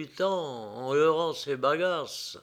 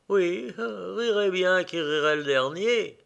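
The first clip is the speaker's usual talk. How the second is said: higher sly voice, gloating; 4 semitones higher, 3 dB louder than usual. A narrator's reading. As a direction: savour the threat, smug. Gloating sinister thought.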